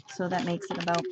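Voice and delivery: ordinary talk, nothing marked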